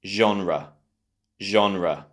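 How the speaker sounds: Genre as ZHON-ruh; genre with a falling schwa ending; model pronunciation. Shown as a demonstration. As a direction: model Genre for learners to repeat. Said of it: "Let's try and pronounce it together".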